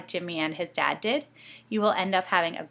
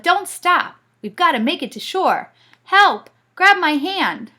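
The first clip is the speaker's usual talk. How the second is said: much higher, louder cries for help; urgent shouting; 11 semitones higher, 11 dB louder than usual. Acted. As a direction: call out desperately for help. Struggling to shore, calling help.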